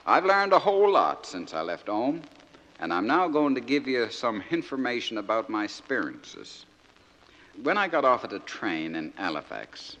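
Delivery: naive outport soldier son reading his letter; broad dialect, lively comic recitation; George from Crinkle Cove. Acted. Soldier son writing home to Mama and Dadda, naive and earnest.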